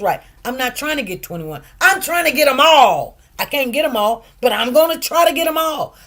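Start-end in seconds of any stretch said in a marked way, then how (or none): 1.8-5.9 louder, higher, fast resolve; fired-up vow; determined. Real, not acted. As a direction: declare it with drive. Determined declaration.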